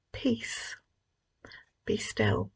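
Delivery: soft, breathy whisper of command; Jesus hushing the wind and waves, in a whisper; hushed whisper. Acted. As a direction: whisper it calmly, stilling the storm. Jesus calms the storm.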